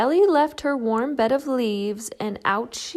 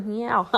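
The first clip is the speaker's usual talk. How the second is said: meow. Acted. Cat meows.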